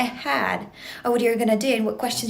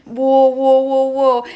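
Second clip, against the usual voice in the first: loud, imitating the friends' reaction; mock alarmed whoas; 8 dB louder, much slower than usual. Friends reacting in a retold story.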